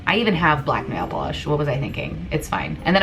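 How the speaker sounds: ordinary talk, nothing marked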